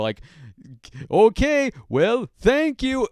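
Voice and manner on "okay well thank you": much higher, much louder, slow mock Russian thanks; bad Russian accent. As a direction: squeak out a hammed-up Russian okay. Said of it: "bad Russian accent".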